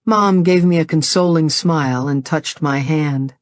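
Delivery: ordinary delivery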